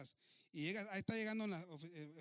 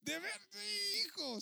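mock wail; 4 dB louder than usual. Pastor's dismay, crying sounds.

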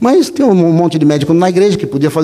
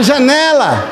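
high, drawn nickname; dawning recognition; 7 semitones higher, much slower than usual. Retold or imitated. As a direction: let it dawn on you slowly.

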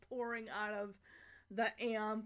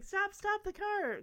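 higher, louder retold call; mock urgent cry; 8 semitones higher, 5 dB louder than usual. Michelle calling from the limo, mock urgent cry. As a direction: imitate someone urgently halting a car.

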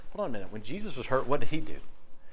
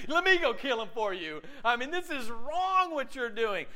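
eager loyal friend; 9 semitones higher than usual. Retold or imitated. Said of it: "Who's like".

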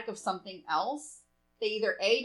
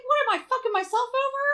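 much higher, louder mock disbelief; incredulous; 14 semitones higher, 8 dB louder than usual. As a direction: throw it out in high disbelief. Mocking her own complaint.

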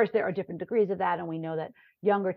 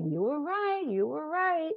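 students admitting; 7 semitones higher, 3 dB quieter, slower than usual. Retold or imitated. Students admitting she was right.

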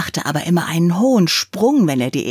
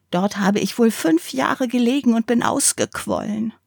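a higher, eager, worn-out ball babbling; chatty old ball; 5 semitones higher than usual. Acted. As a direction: gush with relief at finding company.

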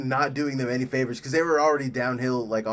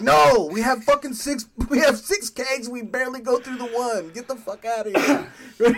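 higher, louder, fed-up yelling; angry bar owner; 9 semitones higher, 6 dB louder than usual. Retold or imitated. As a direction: throw them out, exasperated.

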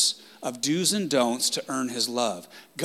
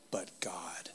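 a softer breath of a whisper; dramatic whisper; 6 semitones lower, 15 dB quieter than usual. Acted. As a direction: hush it even further, almost breathless. Repeating the key phrase.

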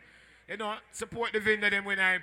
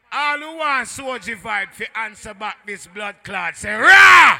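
raised MC call bursting into a shouted "Rah"; crowd call-out; 16 dB louder, slower than usual. Real, not acted. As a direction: rouse the crowd, exploding on the last word. MC rallying crowd, first call.

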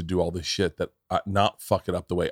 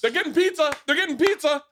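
excited. Retold or imitated. Repeated excited drunk exclamation.

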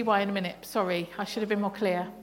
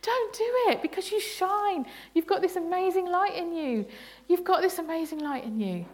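higher, pressing exhortation; Paul urging the church to stop grumbling, higher and pressing; urgent apostle's plea; 7 semitones higher than usual. Retold or imitated. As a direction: plead with them to stop. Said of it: "Paul's saying".

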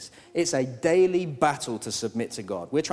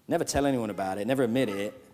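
ordinary talk, nothing marked

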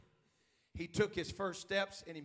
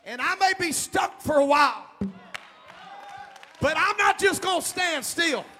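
rising shouted sermon cadence, leaning into each line; full-force preaching; 10 semitones higher, 18 dB louder than usual. Acted. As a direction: preach it out to the whole room.

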